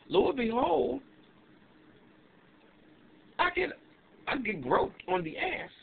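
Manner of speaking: high, outraged disbelief; slow, stunned high telling; shocked. Real, not acted. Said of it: "So I'm sitting there confused".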